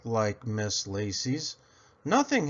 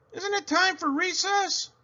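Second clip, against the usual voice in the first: put-on much higher, pleading child voice; hopeful kid question. A narrator's reading. Girl asking the teacher for recess, high and pleading. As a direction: ask like a restless kid.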